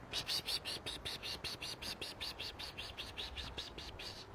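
soft voiceless psst sounds, fading away; cat-luring hiss. Real, not acted. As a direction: hiss softly to lure a cat. (leises Psst-Locken, immer leiser)

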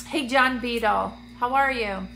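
ordinary talk

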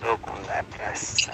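mock depressed whisper. Mock depressed remark during game.

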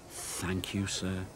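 soft, low thanks; humbly proud. A narrator's reading. Thomas thanks the controller for his praise, humbly proud.

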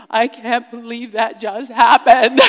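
giddy laughter of relief; laughing disbelief. Retold or imitated. Disbelieving relief after the lion flees.

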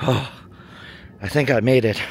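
slow, sighing words after a steep climb; out of breath. Real, not acted. Vlogger reaching the top of a steep climb, out of breath. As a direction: get it out between breaths, worn out. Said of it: "I'm out of breath".

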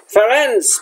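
a sharp high call, name flung out; thundering call. A narrator's reading. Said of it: "Bane thundered".

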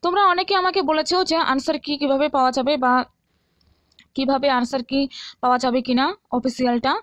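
ordinary talk, nothing marked

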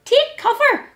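high-pitched storybook exclamation; warning cry. A narrator's reading. Little Hedgehog warning Mouse as cones tumble, high cry. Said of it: "cried little Hedgehog".

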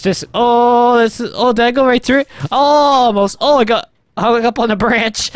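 high, breaking off into cries of oh; astonished live reaction; excited amazement. Real, not acted. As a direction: exclaim as it happens before your eyes. Reacting to crash into tree.

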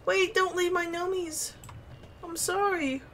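high, urgent calling after friends; high pleading. Real, not acted. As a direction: plead for them to come back. Pleading with fleeing gnomes.

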